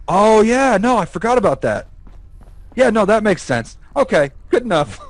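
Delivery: mock a skeptic giving in at once; easily convinced. Imitating a friend persuaded by a movie example, gullible.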